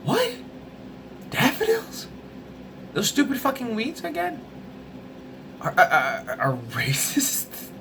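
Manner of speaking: squeaky high incredulous what; baffled disbelief. Pretend. Ranting vlogger acting stunned at daffodil claim, high incredulous squeak. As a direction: play it stunned and baffled, voice jumping way up.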